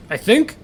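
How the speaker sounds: "think" lifted into a question. Acted. Father doubting his own statement, "think" rising as a question. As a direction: tack on an unsure rising "think". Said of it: "so many fucking question marks".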